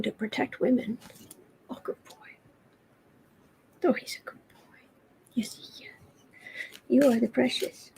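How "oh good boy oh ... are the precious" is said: soft whisper praising a pet; whispered pet talk. Real, not acted. Owner whispering praise to her cat.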